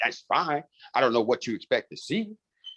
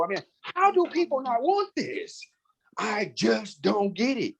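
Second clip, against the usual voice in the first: very high, stretched-out disbelief; exasperated, high-pitched amazement; incredulous; 8 semitones higher, slower than usual. Retold or imitated. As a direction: wail it slowly in utter bafflement. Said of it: "I kept saying to Tiffany DeBerry".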